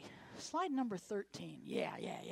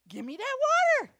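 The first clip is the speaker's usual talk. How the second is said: eager demand; 14 semitones higher, 13 dB louder than usual. Acted. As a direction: give me that water, loud and high with water stretched. Woman demands the water.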